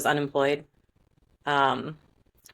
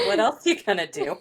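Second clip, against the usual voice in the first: higher-pitched sarcastic quote through laughter; 5 semitones higher than usual. Retold or imitated. Spirit guide chides the doubting channeler, mock sarcastic. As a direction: imitate a teasing, sarcastic guide, amused. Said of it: "My team is very sarcastic".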